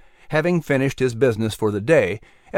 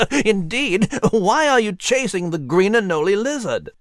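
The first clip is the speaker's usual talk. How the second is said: suppressed giggle; 6 semitones higher than usual. Acted. Nigel withholding giggle.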